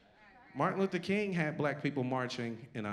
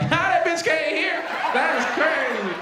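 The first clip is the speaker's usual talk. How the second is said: much higher, louder, cackling; mocking the crowd; 10 dB louder than usual. Imitating the Chicago crowd laughing at the deaf driver, high loud cackle. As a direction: cackle like a rude audience. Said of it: "Y'all was like".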